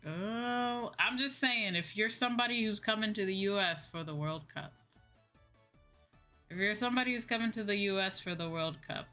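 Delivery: ordinary talk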